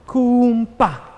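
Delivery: high, loud "Kum, pa"; punchy beat call. Shown as a demonstration. Teacher shows the axe circle.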